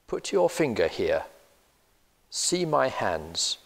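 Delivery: ordinary delivery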